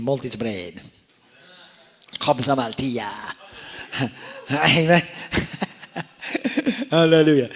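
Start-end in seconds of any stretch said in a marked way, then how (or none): 3.7-4.5 quiet, mostly voiceless laugh; soft chuckle. Real, not acted. Preacher amused by his onion sandwich talk, soft chuckle. 5.1-6.8 higher, breathless giggling laugh; breaking into laughter. Real, not acted. Preacher tickled by his own food digression, breaking into laughter.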